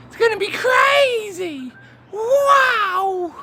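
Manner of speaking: high, loud excited yell; excited shout. Real, not acted. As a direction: shout it with hype. Hyped shout before adventure.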